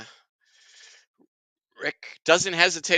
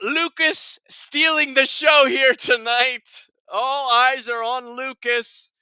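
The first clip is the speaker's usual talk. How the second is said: slow, high, loud showman's call; playful announcer; 7 semitones higher, 5 dB louder, slower than usual. Pretend. Host presenting baby Lucas on screen, grand announcer. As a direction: announce the baby like a star player.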